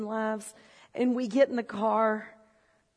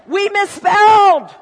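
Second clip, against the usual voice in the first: slow, much louder, higher mock anguish; mock wail; 8 semitones higher, 17 dB louder, slower than usual. To the congregation, mocking his old outrage, loud slow mock wail. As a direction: cry it like a tragedy.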